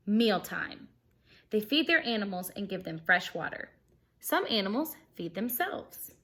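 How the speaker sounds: bright, lively storybook reading; animated read-aloud for kids; reading aloud to children. Acted. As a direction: read it like story time for a class. Reading picture book to class.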